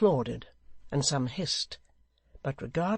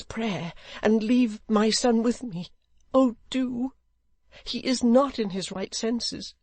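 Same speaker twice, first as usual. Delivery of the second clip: high-pitched pleading mother; earnest supplication; 8 semitones higher, 5 dB louder than usual. A narrator's reading. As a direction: beseech him urgently for your son. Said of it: "earnestness of her supplication".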